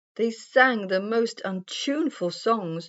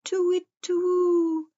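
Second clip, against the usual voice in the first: owl hoot; 12 semitones higher than usual. A narrator's reading. Old wise owl calls over the woods, hooting. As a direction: hoot it like an owl, long and high. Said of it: "he hooted".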